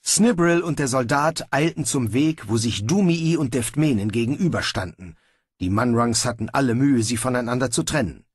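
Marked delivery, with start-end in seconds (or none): none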